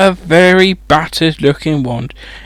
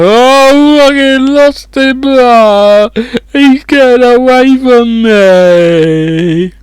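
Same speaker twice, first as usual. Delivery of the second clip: higher, louder hammed-up wail, slow at the end; boy wails to the others that his toad is escaping, hammed-up and higher; 5 semitones higher, 5 dB louder, slower than usual. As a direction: ham up a boy losing his pet. Boy wailing about lost toad.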